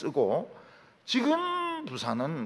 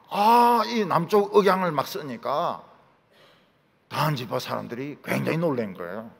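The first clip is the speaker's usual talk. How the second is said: ordinary talk, nothing marked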